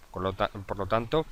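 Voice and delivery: ordinary delivery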